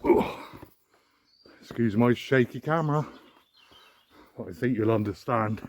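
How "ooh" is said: short, heavy "ooh" of exertion; effortful puff. Real, not acted. Climber scrambling up the steep gorge, puffing. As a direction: blow out a breath from the climb.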